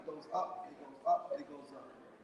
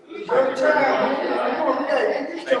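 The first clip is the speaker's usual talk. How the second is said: drawn-out, louder hype call; 5 semitones higher, 7 dB louder, slower than usual. Real, not acted. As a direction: pump up the honoree, stretched out. Hyping honoree at the mic.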